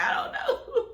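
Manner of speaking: high, wailing mock cry; imitating her own helpless sobbing. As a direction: whimper it out in a high crying voice. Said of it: "And I was like".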